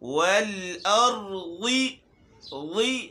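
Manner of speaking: slow والارض with a clear, full زیر on ض; full kasra. Shown as a demonstration. Teacher shows children the full ض kasra, slow. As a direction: voice the ض kasra fully, slowly. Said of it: "حرکت ... پوری ادا نہیں کرتے".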